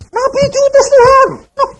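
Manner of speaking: high, loud ecstatic cry; prisoner yelling. Retold or imitated. Prisoner shouting at the preaching doctor, loud high cry. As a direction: shout it like a man possessed in a cell. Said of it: "teriak-teriak lagi di penjara".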